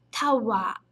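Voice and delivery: "tawa" com o a final bem prolongado; long final vowel. Shown as a demonstration. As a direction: estica a última vogal de "tawa". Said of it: "E o prolongamento". ("tawa" com a vogal final alongada)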